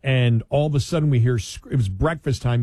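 usual speaking voice

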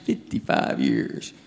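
very soft, slow, nearly whispered; hushed repeat. Real, not acted. Comic dwelling on a long marriage, hushed repeat. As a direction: drop to a slow hush for emphasis.

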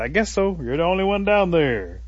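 high, loud holler down; storyteller's long-distance call; calling back. Acted. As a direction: shout back from up in a tree. Possum calls down to Turtle.

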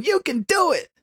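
high, peppy you can do it; mock cheerleading coach. Imitating Susan Powter cheering women on, high and peppy. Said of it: "telling women".

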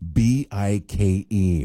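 letters spelled out one by one; slow, deliberate spelling; spelled out slowly. Shown as a demonstration. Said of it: "spelling the word out".

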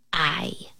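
soft little parrot answer. A narrator's reading. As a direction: say it softly as a parrot. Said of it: "said the parrot".

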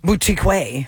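Boutique with "way" tacked on; joke pronunciation. Shown as a demonstration. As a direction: say Boutique way as "boo-tee-KWAY". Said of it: "What if they said boutique way?".

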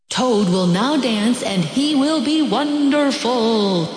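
high, slow announcer from far away; a distant voice presenting Toad on stage; strange announcer voice. Acted. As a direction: announce it like a grand master of ceremonies. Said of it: "said the voice".

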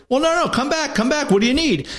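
high, pleading call-back; urgent calling back. Retold or imitated. Detective coaxing a wary drug buyer back, high urgent calling.

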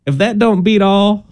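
a hint of a Southern accent; southern drawl. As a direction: play it like a Southern grandma. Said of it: "Why is your voice getting southern sounding".

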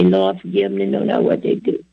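hushed, sinking to a whisper; quiet prayer. Retold or imitated. Forgiving his mockers, in a hushed prayer. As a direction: pray it softly under your breath. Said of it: "That's how I say it".

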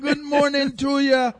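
loud, high squawk with a Jamaican lilt; Jamaican parrot. Acted. Parrot greeting [name], piercing Jamaican-accented squawk. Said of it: "Did he have a Jamaican accent?".

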